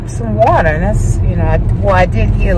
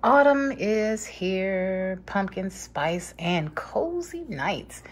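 reading softly; 2 semitones higher, 11 dB quieter, much slower than usual. Real, not acted. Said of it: "this cute little sign".

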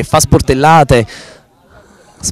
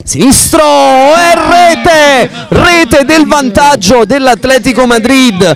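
high, drawn-out goal shout; breathless italian goal commentary; 10 semitones higher, slower than usual. Real, not acted. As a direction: sing out the winning goal. Penalty goal call.

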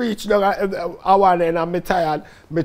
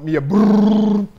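imitated running sound; 3 semitones higher, 5 dB louder, slower than usual.

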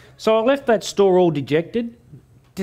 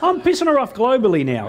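louder and higher through laughter; laughing gloat; 7 semitones higher, 4 dB louder than usual. Real, not acted. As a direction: gloat with a grin. Gloating punchline.

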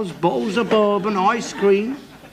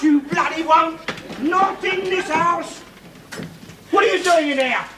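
angry shouting; 8 semitones higher than usual. Acted. Father ordering the family about, high angry shouts. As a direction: bark orders at them across the room.

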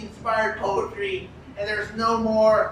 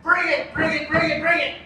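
higher, punchy, repeated taunt; eager drunk bravado; 5 semitones higher than usual. Acted. Drunk Bukowski daring the moon to fight, cocky eager taunting. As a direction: egg the moon on, itching to fight. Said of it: "Charles Bukowski says".

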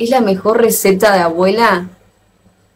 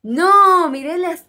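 sudden high-pitched surprised cry; surprised outburst; 9 semitones higher, much slower than usual. Real, not acted. Streamer spots tasty food on screen, high surprised cry. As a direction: blurt it out as something catches your eye.

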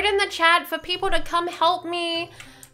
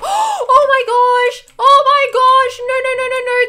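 loud, higher startled cry; frightened shout; 5 semitones higher, 8 dB louder than usual. Real, not acted. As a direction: yell it, truly spooked. Sudden scare by the ghost.

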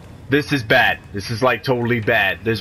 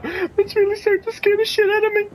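high thin frightened voice; squeaky high scared admission; terrified. Real, not acted. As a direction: let the fear push the voice way up. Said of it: "'cause I'm really terrified".

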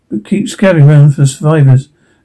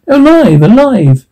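much higher, amazed cry; 8 semitones higher than usual. Acted. Woman finding survivors in the nest, amazed. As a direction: marvel that anyone survived. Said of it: "amazed woman".

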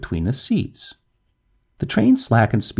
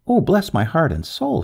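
raised-pitch exclamation of dismay; understated cry of shock; startled outcry; 6 semitones higher than usual. A narrator's reading. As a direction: exclaim in mild storybook alarm. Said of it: "she cried out".

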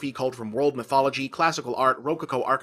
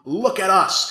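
loud, raised-pitch mock scream; 5 semitones higher, 8 dB louder, slower than usual. Money shots begging to be seen, mock screaming. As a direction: cry it out like a show-off demanding attention. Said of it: "all constantly screaming".

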